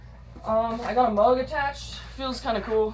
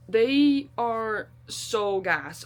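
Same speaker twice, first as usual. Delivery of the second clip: very slow, each word stretched; drawn-out gush; much slower than usual. Real, not acted. Hiker showing off her new shoes, drawn out. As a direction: savour it slowly.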